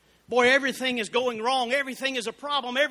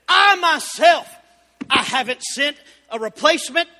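full-force preaching; 3 semitones higher, 9 dB louder than usual. Acted. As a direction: thunder it out from the pulpit.

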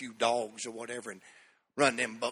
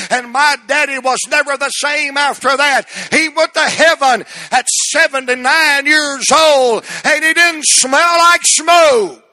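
high, loud preaching cry; full preaching; 12 semitones higher, 19 dB louder than usual. Real, not acted. Preacher at the climax, crying out the prayer at full force.

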